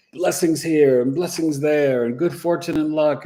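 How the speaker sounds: high, loud, overjoyed gushing; Jupiter's voice. Retold or imitated. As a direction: gush like a generous god showering gifts. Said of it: "Jupiter is just like".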